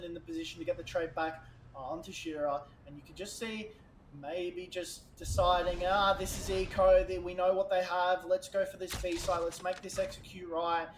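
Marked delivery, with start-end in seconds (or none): none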